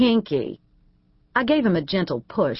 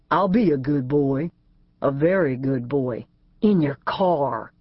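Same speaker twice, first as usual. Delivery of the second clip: lower, slow suggestive echo; sly teasing fiancé; 3 semitones lower than usual. A narrator's reading. A J echoing Keely's words back, lower and slow, teasing. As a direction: turn her words into a come-on.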